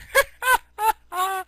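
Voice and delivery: high, loud burst of laughter; real laugh. Real, not acted. Storyteller amused by a three-letter name, real high laugh. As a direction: break up laughing at your own story.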